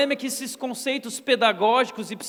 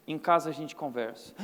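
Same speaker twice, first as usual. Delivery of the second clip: low, quiet, ominous father's warning; stern father; 7 semitones lower, 4 dB quieter than usual. Retold or imitated. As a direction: lower the voice like a stern dad. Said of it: "Quando meu pai virava e falava assim". (pai severo, voz baixa e ameaçadora)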